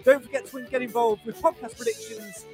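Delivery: big, high radio sign-off voice; announcer outro. Acted. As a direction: sell the sign-off like a trailer. Show outro over music.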